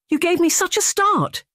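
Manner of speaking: high, breathless startled cry. Acted. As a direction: caught off guard, heart racing. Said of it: "holding a hand to her pounding heart".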